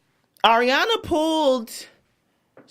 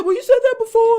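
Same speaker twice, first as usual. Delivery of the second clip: high, louder imitation of a wife; mock upset wife; 10 semitones higher, 5 dB louder, faster than usual. Wife challenging husband's excuse, mock upset. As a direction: throw it back at him, pitched way up. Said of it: "She said".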